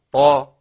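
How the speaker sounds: «طا» loud, with an emphatic dark t; heavy ta. Shown as a demonstration. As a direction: sound a full, heavy «طا». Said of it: "الطاء مفخمة". («طا» بطاء مفخمة)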